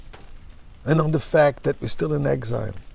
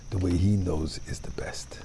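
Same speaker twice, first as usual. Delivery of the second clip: usual speaking voice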